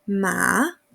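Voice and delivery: MA dipping low, then rising; ma stretched through a fall and rise; third tone. Shown as a demonstration. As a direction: sink on ma and lift it back up. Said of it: "Tercer ejemplo".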